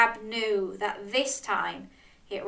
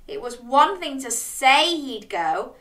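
ordinary delivery

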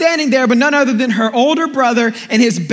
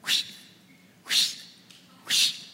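whoosh sound; 14 dB quieter, slower than usual. Retold or imitated. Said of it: "you heard".

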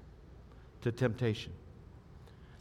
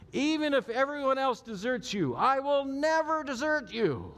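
much higher, louder, brash vow of loyalty; boastful Peter; 11 semitones higher, 4 dB louder than usual. Retold or imitated. Preacher voicing Peter vowing loyalty to Jesus, high and brash. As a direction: play Peter swearing he'll never fall away. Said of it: "Peter declares".

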